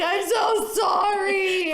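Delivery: loud, high, drawn-out tearful wail; hammed-up drunken sobbing apology; mock crying. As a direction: wail it to God, half laughing. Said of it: "And I'm crying".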